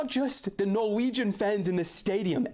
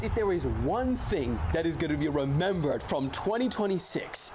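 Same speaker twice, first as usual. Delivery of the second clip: usual speaking voice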